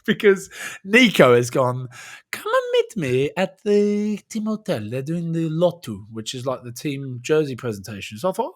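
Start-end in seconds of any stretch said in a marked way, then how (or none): 2.3-6.0 higher, slow imitation of Nico's Fijian accent. Retelling Nico's invite to the team hotel, Fijian accent mimicry. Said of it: "Your mimicry of, uh, Nico's voice and accent is just uncanny".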